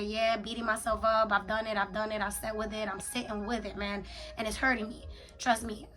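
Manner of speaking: ordinary talk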